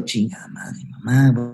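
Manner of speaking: hushed, breathless swear under the breath; a whispered curse at the sight of mom. Retold or imitated. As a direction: mutter it so nobody near can hear. Cursing under breath on seeing mom.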